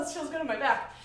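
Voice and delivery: usual speaking voice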